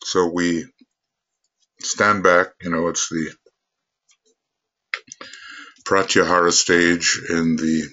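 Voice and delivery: measured meditation leading with long pauses; guided meditation. Real, not acted. Teacher leading a group to withdraw their consciousness, guided meditation. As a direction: guide the group inward, phrase by unhurried phrase.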